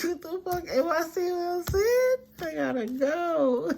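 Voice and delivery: high, laughing mock fright; laughing mock panic. Imitating a spooked person while laughing. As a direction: squeal it out through laughter.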